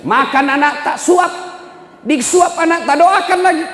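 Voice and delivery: high, loud rallying call to the parents; calling out loudly. Real, not acted. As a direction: rouse the crowd, call it out high. Preacher calls out to audience. (seruan lantang kepada jamaah)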